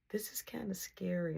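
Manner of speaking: hushed and breathy, as if the movie might hear; scared whisper. Retold or imitated. Girl whispering to him during the horror movie, scared whisper. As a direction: whisper it, a little spooked. Watching a scary movie together.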